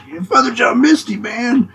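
mock half-hearted fan cheer, pitched high; a hammed-up limp concert whoop; lame fan cheer. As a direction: imitate a bored fan yelling weakly. Mimicking a lame crowd cheer.

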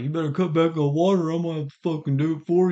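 a hint of a bossy governor, lightly voiced; mock Newsom. Mocking Newsom lecturing Californians about water, lightly voiced. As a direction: lightly parody a scolding politician. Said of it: "'cause he's like".

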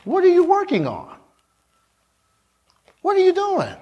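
high-pitched, insistent questioning; preacher's high, pointed challenge; pressing challenge. Real, not acted. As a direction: put the question high, then let it drop. Preacher challenges the congregation directly.

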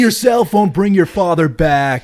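pleading shout. Acted. Acting Bill Paxton's pleading shout.

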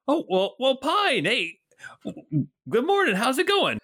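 put-on high, hearty old watchman greeting a friend; Bert the old guard. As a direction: boom out a cheery hello as a portly guard.

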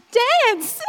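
loud, high imitated urging; excited command. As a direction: act out an eager order to a friend. Acts out Kathleen urging her on.

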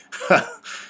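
high bursting laugh; hearty laugh. Acted. Father laughing at his own joke, high and hearty. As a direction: let out a big laugh. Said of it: "He laughed".

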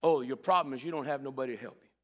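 Jesus addressing the lame man, low and calm; Jesus gently speaking. Acted. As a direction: tell him plainly what he lacks.

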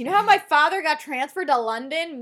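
mock-chatty teen announcing news; breezy retold Ashley. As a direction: toss it out brightly, imitating her. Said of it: "Ashley's just like".

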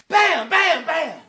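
high shouted bam sounds; mock banging noise. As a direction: punch out each bam like hitting the dash. Imitating banging the dashboard.

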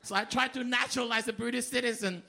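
ordinary delivery

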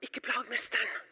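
hushed crowd telling someone off; low, unvoiced shushing. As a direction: shush sharply, then warn in a low hush. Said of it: "Og så sier de".

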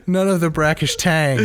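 stifled giggles. Real, not acted. Giggling through ad copy.

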